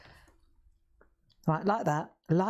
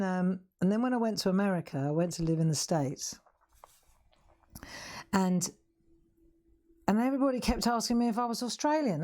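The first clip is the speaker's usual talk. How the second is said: ordinary talk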